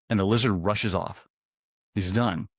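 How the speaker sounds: ordinary delivery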